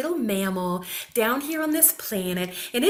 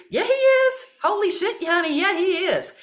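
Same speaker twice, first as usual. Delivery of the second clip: much higher and louder retort; emphatic retort; 8 semitones higher, 3 dB louder than usual. Acted. Answering an imagined unhappy spouse, an emphatic retort. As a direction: fire it right back.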